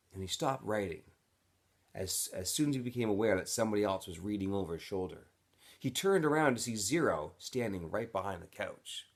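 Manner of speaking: usual speaking voice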